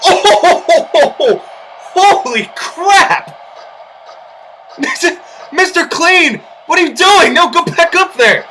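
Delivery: high, loud laughing disbelief; laughing shout. Real, not acted. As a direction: laugh and yell at the blunder. Laughing shout at Mister Clean's blunder.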